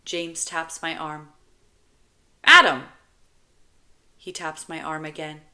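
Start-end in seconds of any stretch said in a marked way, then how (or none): 2.4-2.9 loud, high call for attention; sharp burst of volume; child calling out. Acted. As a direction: yell the name like an ignored kid. Child trying to get attention.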